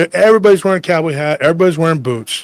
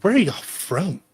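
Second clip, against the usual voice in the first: slow, drawn-out mock disdain; snooty little punk, imitated; disdainful; 3 semitones higher, 7 dB quieter, slower than usual. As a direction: ask it slowly, looking down on them. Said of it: "He says it with this disdain".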